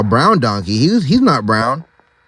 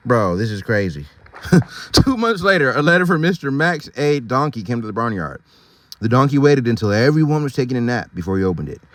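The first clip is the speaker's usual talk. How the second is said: ordinary delivery, nothing marked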